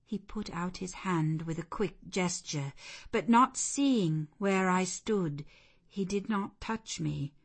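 ordinary delivery

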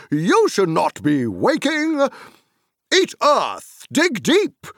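high, loud scolding; booming rustic rebuke; stern Tom Bombadil. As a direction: command the tree to behave. Tom chanting at the tree.

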